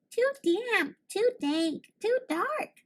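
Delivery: high, tiny squeaking mouse voice; little mouse squeaking; squeaky mouse. A narrator's reading. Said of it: "Mouse squeaks".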